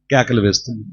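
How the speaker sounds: ordinary talk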